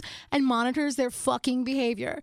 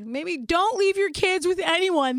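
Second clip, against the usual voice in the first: loud, high mock announcement; emphatic warning; 7 semitones higher, 7 dB louder than usual. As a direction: proclaim it as a ranting public warning. Mock public service announcement, ranting.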